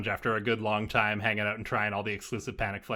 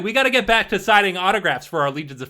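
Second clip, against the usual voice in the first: higher, louder show-off band member; festival star; 7 semitones higher, 7 dB louder than usual. Acted. Festival star to newcomers about the trophy, higher-pitched send-off. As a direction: play a star sending off the newcomers.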